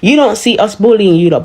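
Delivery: ordinary talk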